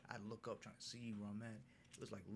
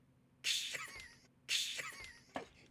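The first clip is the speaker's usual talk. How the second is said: loud squealing burst of laughter; high shrieking laugh; 10 dB louder than usual. Real, not acted. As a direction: crack up at himself. Laughing at his mistake.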